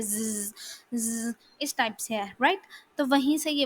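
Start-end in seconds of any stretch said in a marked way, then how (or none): 0.0-1.3 soft buzzing "zzz" like a bee. Shown as a demonstration. Teacher imagining a honeybee's sound for students, imitated buzz. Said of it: "वो buzz type ही है".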